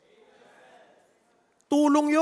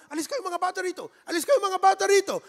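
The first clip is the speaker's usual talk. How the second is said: shooing disciples; 7 semitones higher, 3 dB louder, faster than usual. Acted. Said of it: "Tapos sabi ng mga disipulo".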